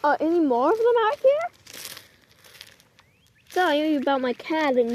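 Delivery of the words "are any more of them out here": high cooing pet voice. Real, not acted. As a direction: coo up high like to kittens. Talking to found kittens.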